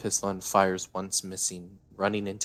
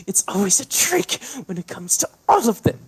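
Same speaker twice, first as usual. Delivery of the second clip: tense whisper; 11 dB louder than usual. Acted. Davine hissing paranoid.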